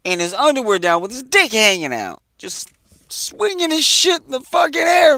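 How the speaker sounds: building from disbelief to an outraged shout; shocked retelling. Retold or imitated. Telling his audience about the naked man, rising to a shout.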